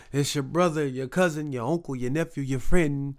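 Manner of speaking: ordinary talk, nothing marked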